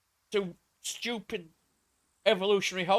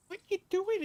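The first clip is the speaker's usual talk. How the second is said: high-pitched retold alarm; startled question; 13 semitones higher than usual. Retelling his reply to the ex-girlfriend, high and startled.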